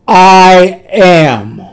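loud, sustained proclamation; powerful declaration. Acted. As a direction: declare it with commanding force, each word held. Jesus' declaration felling the soldiers.

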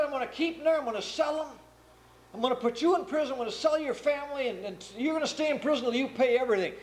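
high, fast stern threats; threatening king. Retold or imitated. King threatening the debtor and his family, high fast stern threat. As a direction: rattle off the punishments, fast and hard.

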